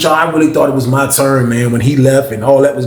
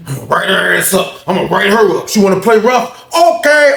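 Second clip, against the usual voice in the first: worked-up, vengeful muster, pitch climbing; retold indignant threat; mad; 6 semitones higher than usual. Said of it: "I'm mad, mad, y'all".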